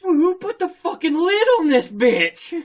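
high, loud cry of disbelief; outraged mock yell. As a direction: yell as if comically trapped. Retold shock at being trapped underwater.

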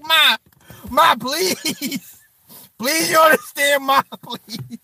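high-pitched mock begging; drawn-out, hammed-up plea; pleading kid. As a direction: beg mom like a desperate kid. Acting out kids begging mother.

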